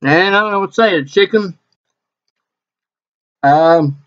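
chewing while talking; speaking around food; mouth partly full. Real, not acted. Seen 3: bit the chip at -4; jaw keeps chewing through and after.

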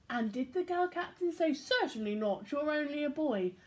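put-on lively read-aloud for children; animated storybook reading; story narration. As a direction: tell it like a bedtime picture book. Reads picture book aloud.

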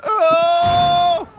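sudden loud gasp of Oh; startled shout. Real, not acted. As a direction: jolt out a loud Oh. Sudden shock in game.

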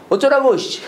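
high, irritated mock retort; acted anger. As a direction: play someone fed up and snapping back. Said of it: "화내면서 얘기했을 것 같아".

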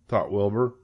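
ordinary delivery, nothing marked

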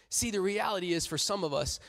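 usual speaking voice

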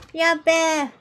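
loud call across the room; shouting a greeting. Real, not acted. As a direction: call her name out loud. Calling out to Beth loudly.

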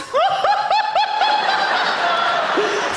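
high, loud shrieking hoot of laughter; a shrill howling royal cackle; mock royal laugh. The Queen laughing at the email.

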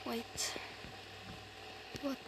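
normal talking voice

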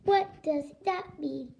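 evenly spaced robot words. Acted. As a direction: ask it like an uncomprehending machine. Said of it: "I am a robot".

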